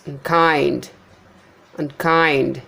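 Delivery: unkind as un-KIND; light un, long KIND in unkind; second-syllable stress. Shown as a demonstration. Teacher showing unstressed prefix, unkind stressed on KIND. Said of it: "prefixes are not stressed".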